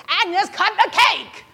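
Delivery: high, loud, drawn-out shout; impatient yell. Retold or imitated. Harry repeats himself to hesitating Agnes, impatient yell. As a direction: bellow the order word by word.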